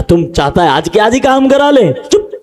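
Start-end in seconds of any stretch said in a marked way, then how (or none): 1.9-2.3 a sudden high, soft "चुप"; sharp hush. Acted. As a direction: hiss the word at him. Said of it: "बोलते थे". (तीखा, धीमा "चुप")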